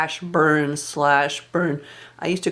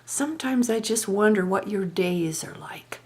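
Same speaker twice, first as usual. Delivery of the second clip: whispered mock concern; 4 semitones higher than usual. Mother-in-law whispering condescension to Margaret. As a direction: hiss it like a sly confidante. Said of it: "she said".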